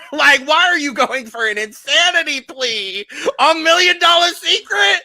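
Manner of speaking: high, loud shriek through laughter; helpless laughing yell, stretched out at the end; laughing incredulity. Real, not acted. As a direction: cry out in hilarious disbelief. Cracking up over insanity plea.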